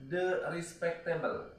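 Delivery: teacher modelling the pronunciation; careful English pronunciation. Shown as a demonstration. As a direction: say "the respectable" slowly the English way. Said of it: "silahkan ditulis dulu".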